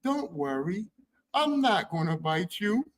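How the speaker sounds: lightly voiced sad creature, soft and gentle; a hint of a lonely spider comforting you; sad sweet spider. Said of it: "the spider talks just like that, like, sad, low voice, sweet".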